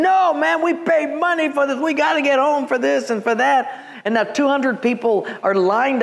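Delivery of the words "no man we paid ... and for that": high, indignant mock protest; angry crowd, imitated. Steaming passengers protesting a canceled flight, mock angry. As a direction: act out a steaming customer's rushed complaint. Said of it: "livid mad. They are steaming".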